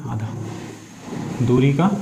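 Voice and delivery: ordinary talk, nothing marked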